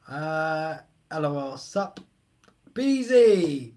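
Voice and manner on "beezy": put-on loud, higher Aussie accent, half Scottish; attempted Aussie accent. Streamer greeting chatter Beezy, failed Aussie accent. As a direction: try an Aussie accent and miss. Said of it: "I can't do an Aussie accent".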